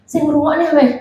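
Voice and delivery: ordinary talk, nothing marked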